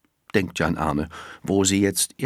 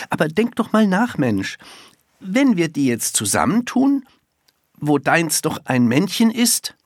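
higher, lightly voiced boy reasoning with her; hint of an impatient boy; exasperated schoolboy; 10 semitones higher, 4 dB louder than usual. A narrator's reading. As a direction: spell it out, pitch raised. Boy's held dialogue voice.

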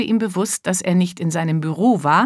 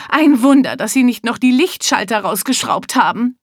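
agitated rich man; 4 semitones higher than usual. Acted. Mister Hilarious telling his wife about the burglary, outraged. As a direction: rattle off the stolen goods in outrage.

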